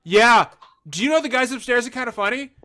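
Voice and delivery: loud, high, over-eager question; acted jumpy outburst; nervous blurting. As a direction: blurt it out with jittery nerves. Nervous customer blurting at store owner.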